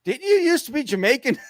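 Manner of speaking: high, laughing mock wrestler voice; squeaky high put-on voice breaking into a laugh. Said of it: "He goes".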